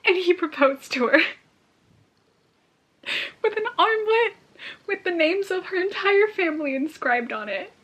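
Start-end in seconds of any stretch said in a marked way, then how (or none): 0.0-5.8 higher, excited telling. Real, not acted. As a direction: announce it thrilled. Same news said aloud.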